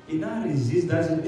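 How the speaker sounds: ordinary talk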